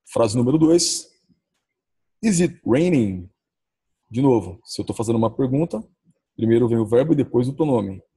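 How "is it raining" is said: slow model sentence. Shown as a demonstration. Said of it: "É assim, ó".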